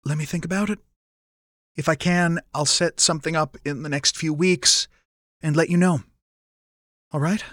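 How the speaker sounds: holding emotion back; choked up, understated. A narrator's reading. Len answering his mother with throat closed up, choked. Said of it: "fought to get words past the constriction".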